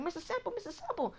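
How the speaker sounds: high-pitched, urgent calling of the name; a kid tugging at the teacher for attention. Retold or imitated. Student urgently calling the teacher's name, high and pleading. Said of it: "And he was like".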